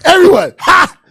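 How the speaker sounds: high, loud manic mom with cackling laughs; a mom who just snapped, laughing wildly; snapping mom. Retold or imitated. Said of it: "another version he do where she snaps".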